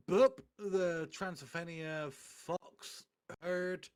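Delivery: normal talking voice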